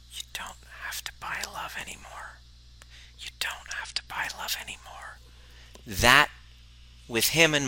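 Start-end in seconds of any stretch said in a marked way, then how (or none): 0.1-2.4 soft unvoiced whisper, close and intimate; whisper in his ear. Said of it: "whispering in his ear the whole time". 3.2-5.2 soft unvoiced whisper, close and intimate; whisper in his ear. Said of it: "whispering in his ear the whole time".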